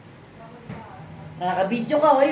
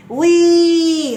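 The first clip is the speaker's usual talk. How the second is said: long, high, loud "wee"; high playful call; playful squeal; 6 semitones higher, 8 dB louder than usual. Real, not acted. Playful squeals while luring pet.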